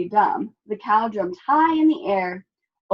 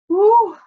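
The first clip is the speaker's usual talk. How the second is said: loud high whew; relieved sigh; 13 semitones higher, 10 dB louder than usual. A narrator's reading. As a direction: blow out in relief. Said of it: "Cow sighed".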